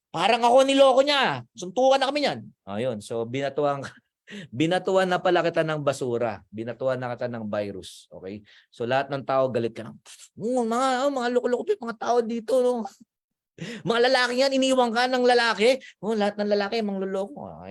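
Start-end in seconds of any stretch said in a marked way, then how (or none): 0.1-2.4 high, louder threat to fight; mock furious. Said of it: "galit na galit siya". 10.4-17.5 high, fast, scornful complaint; bitter rant. Retold or imitated. Bitter person ranting at everyone.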